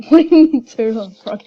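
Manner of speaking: loud, laughing incredulous question; amused disbelief. Real, not acted. Player reacting to a turtle frog, loud amused disbelief. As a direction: burst out loudly, laughing in disbelief. Sudden disbelief at what appears.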